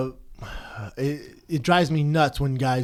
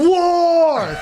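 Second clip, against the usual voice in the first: yelled war, higher and louder; shouting war; 13 semitones higher, 6 dB louder than usual. Retold or imitated. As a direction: bellow it like a hype announcer. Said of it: "He'd go".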